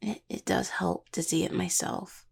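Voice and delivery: soft breathy whisper close to the ear; hushed whisper to a partner. Acted. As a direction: whisper it, almost no voice. Intimate moment, speaking close.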